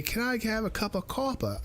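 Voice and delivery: high, quick mock British accent; playful British accent, brisk and high. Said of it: "I gotta do the accent".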